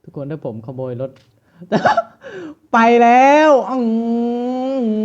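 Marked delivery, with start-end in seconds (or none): 1.9-5.0 gleeful laugh. Real, not acted. Just stole the car.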